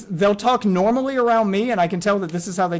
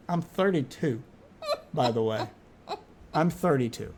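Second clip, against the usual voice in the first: mock sad; 4 semitones lower, 6 dB quieter than usual. Mock sad aside to dad.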